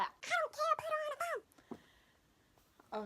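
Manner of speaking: very high, exasperated mom voice; mom's high squeal. Retold or imitated. Mother insisting on talking to the teacher, high and exasperated. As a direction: squeak it out, fed up. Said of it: "She's like".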